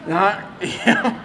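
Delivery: soft laugh through the words. Real, not acted. Pastor laughing at his joke about his son. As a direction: chuckle at his own joke.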